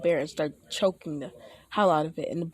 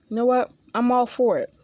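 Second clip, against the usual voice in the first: higher and louder, eager burst; 5 semitones higher, 5 dB louder than usual. Acted. Izuku eagerly accepts Aizawa's offer, bursting with excitement. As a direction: jump at the offer.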